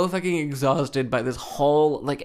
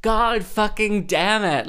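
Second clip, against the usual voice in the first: higher, louder slow curse; exasperated curse; 6 semitones higher, 4 dB louder, slower than usual. Retold or imitated. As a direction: swear in exasperation, drawn out. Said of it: "it was just like".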